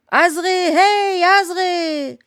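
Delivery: high, loud, long-drawn shout; calling out. A narrator's reading. Same haïdouk calling Azré back from the fire, loud drawn-out shout. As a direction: holler slowly to someone far away. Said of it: "appela la même voix".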